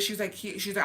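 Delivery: normal talking voice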